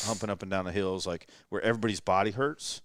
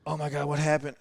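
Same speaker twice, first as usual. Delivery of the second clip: higher-pitched mock astonishment; retold shock; 7 semitones higher than usual. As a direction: imitate a friend's startled question. Retelling a friend's shocked reaction.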